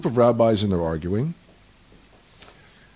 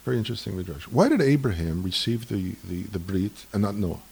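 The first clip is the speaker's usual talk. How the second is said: normal talking voice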